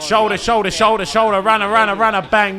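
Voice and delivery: loud shouts for the pass; calling for the ball. Real, not acted. Player calling teammates for the ball. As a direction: yell for it from the pitch.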